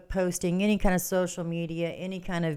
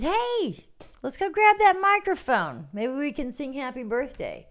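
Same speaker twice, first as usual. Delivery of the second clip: much higher, bright eager voice; perky friend; 8 semitones higher than usual. Retold or imitated. Quoting Kim's eager idea for a birthday song. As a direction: pitch it way up like an excited friend. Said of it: "and Kim said".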